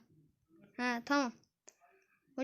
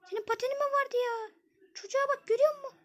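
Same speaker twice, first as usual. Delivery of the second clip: put-on girl voice; 9 semitones higher than usual. [name] teases about the skates.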